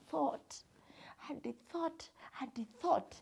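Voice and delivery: soft, suspenseful repetition, trailing into thought; murmured storytelling, as someone thinks it over; hushed pondering. A narrator's reading. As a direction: drop almost to a whisper. Hare thinks hard in the story.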